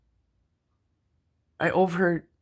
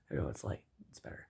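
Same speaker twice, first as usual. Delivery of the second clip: whisper; 10 semitones lower, 13 dB quieter, faster than usual. Real, not acted. Aside about the late hour.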